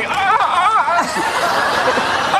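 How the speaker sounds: high, breathless mock panic gasps; panicked gasps. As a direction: gasp in mock horror, high and loud.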